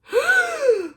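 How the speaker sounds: sharp high gasp of relief; big gasp. Retold or imitated. Retelling the escape, a sharp high gasp. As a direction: gasp like you just escaped danger.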